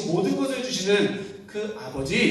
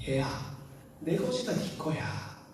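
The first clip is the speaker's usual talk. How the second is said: tender comfort; 9 dB quieter than usual. Acted. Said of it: "위로해주고 만져주는".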